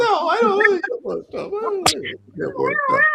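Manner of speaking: laughing it off in a put-on high voice; playful denial. Teasing back at the co-host who loves him worked up. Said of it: "I love it when you get worked up".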